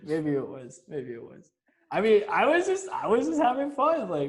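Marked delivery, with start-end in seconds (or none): 1.9-4.1 high defensive protest. Real, not acted. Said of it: "defends himself laughing".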